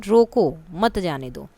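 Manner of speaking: slow "रोको", pause, then "मत जाने दो"; रोको के बाद विराम. Shown as a demonstration. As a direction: command to halt, with a break after रोको. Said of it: "रोको के बाद अल्प विराम". ("रोको" पर रुककर "मत जाने दो")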